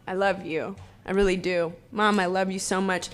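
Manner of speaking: soft, tender words to mom; real heartfelt love for mom. Real, not acted. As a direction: say it softly, just to her.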